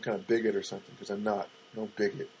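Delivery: low, soft aside; quiet disclaimer. Real, not acted. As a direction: say it under your breath, low. First, muttered disclaimer.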